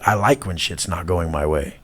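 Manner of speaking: ordinary talk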